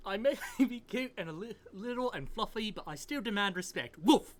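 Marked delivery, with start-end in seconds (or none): 4.0-4.4 loud playful woof; dog bark. Acted. Professor dog barks.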